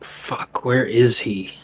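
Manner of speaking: hushed, half-whispered cursing; soft frustrated mutter; muttered under breath. Acted. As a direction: curse quietly to yourself. Character cursing to himself while searching.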